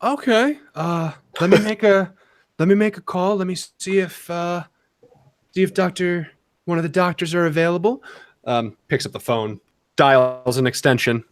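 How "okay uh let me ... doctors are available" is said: receptionist voice. Acted. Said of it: "he says".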